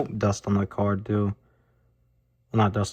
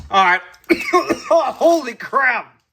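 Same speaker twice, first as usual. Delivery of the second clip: real coughing fit, choked words; coughing on dust; 13 semitones higher, 6 dB louder, slower than usual. Real, not acted. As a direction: cough hard, then gasp the words. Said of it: "me just sitting here and coughing".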